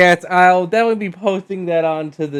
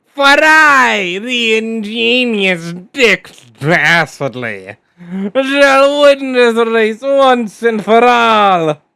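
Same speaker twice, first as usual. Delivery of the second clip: evil villain voice; 4 semitones higher than usual. Acted. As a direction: proclaim victory like a cartoon villain. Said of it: "I have an old school villain voice".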